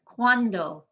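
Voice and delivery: "Cuando" in two syllables, U and A blended as "kwan-do"; U and A together. Shown as a demonstration. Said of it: "The U and the A in cuando work together".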